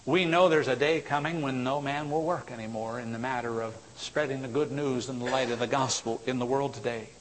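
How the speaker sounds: ordinary talk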